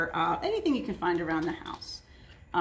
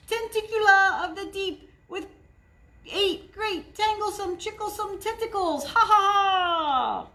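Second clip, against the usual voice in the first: villainous sea creature; 10 semitones higher, 4 dB louder than usual. A narrator's reading. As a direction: gloat like a sea monster. Sister's villain persona.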